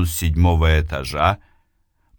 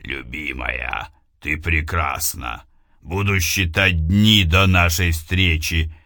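echoing ethereal energy being; 3 semitones lower than usual. Acted. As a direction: speak low like a distant spirit. Голос любимого. (низкий потусторонний голос)

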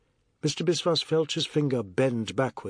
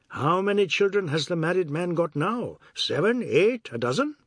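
higher-pitched character voice, lilting questions; teasing Ajoda; 5 semitones higher than usual. Acted. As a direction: needle him with higher, singsong questions. Ajoda teases about the children.

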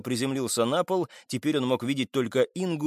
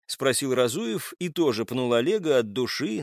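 plain reading voice

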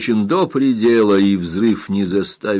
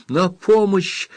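high-pitched, drawn-out cry for help; urgent cry for help; 9 semitones higher, slower than usual. A narrator's reading. Girl runs into the pagoda for help, crying out. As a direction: call out in alarm, stretching the words. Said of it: "закричала она".

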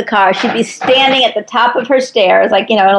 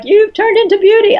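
imitating mom in a much higher voice; exasperated mother; 11 semitones higher than usual. Daughter quotes her mother comparing her to grandmother, mock-complaining mother voice. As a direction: put on mom's high-pitched jab. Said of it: "my mother would always be like".

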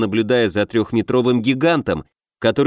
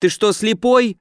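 higher indignant outburst; much higher, shrill indignation; flaring up; 9 semitones higher than usual. A narrator's reading. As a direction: flare up at the dim guard. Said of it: "взвился секретарь".

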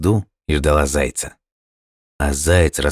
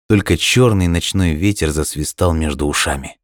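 plain reading voice

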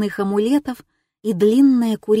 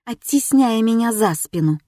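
plain reading voice

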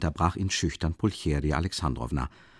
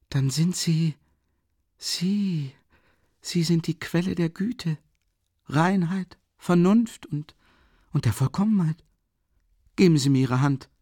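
higher, gushing praise; rapture; 10 semitones higher, 4 dB louder than usual. Acted. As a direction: swept away with delight. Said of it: "rief er voll Entzücken aus".

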